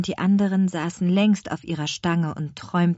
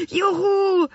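very high joyful whoop; cheering; 14 semitones higher, 4 dB louder than usual. A narrator's reading. The twins cheer at the promised ride. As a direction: jump for joy. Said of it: "riefen sie".

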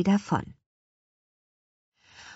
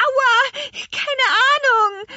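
very high, loud, stretched wail; panicked high-pitched yelling; pained shriek; 5 dB louder, slower than usual. Acted. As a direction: squeal while being pelted. Twins hit, crying out.

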